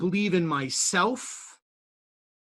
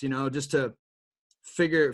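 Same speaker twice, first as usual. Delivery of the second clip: usual speaking voice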